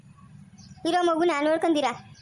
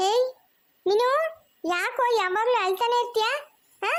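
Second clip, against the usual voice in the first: put-on high, teasing voice for a baby; baby talk; 8 semitones higher than usual. Aunt asking the baby why he always cries, high baby talk. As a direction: playfully question a little one.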